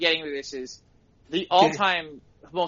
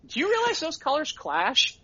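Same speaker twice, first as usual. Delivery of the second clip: mock fussy critique; 10 semitones higher than usual. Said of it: "Lou's comment would be like".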